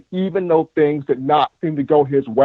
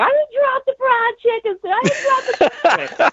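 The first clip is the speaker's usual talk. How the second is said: very high, tearful aunt protesting through sobs; crying aunt. Retold or imitated. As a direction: insist through tears in a squeaky aunt voice. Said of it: "my auntie is just bawling".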